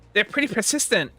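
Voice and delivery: plain character voice. Acted. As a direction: say it clean, no lisp. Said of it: "Why did I give her a lisp?".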